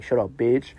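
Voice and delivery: playful mock insult, tossed off lightly; joking voice. Coworker teasing the hostess, light joking shut-up. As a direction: tease her like a friend, not meaning it. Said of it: "in the most joking voice".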